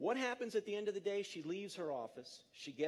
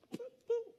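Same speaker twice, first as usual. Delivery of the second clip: boop sound effect. Acted. Speaker clicking to next slide, playful high boops. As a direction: chirp two silly high beeps.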